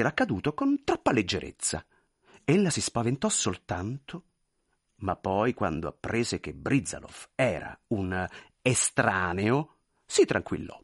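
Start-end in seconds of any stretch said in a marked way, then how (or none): none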